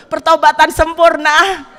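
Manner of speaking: real laughter through the line; laughing. Real, not acted. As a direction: laugh the words out loud. Laughing at her own story.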